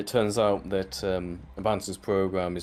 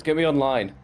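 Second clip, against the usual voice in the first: louder, higher mock demand; imitated demand; 6 semitones higher, 7 dB louder than usual. Retelling the AI demanding to get online, mock demanding. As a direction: demand it insistently in a raised voice.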